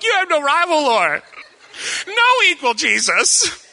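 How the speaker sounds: very high, squeaky pretend sobbing; mock bawling. Joking about breaking down during a worship song, mock bawling. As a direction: wail it like a hammed-up worship crier. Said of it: "I'm joking and I'm about to cry".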